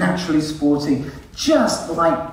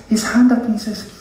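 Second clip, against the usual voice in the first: usual speaking voice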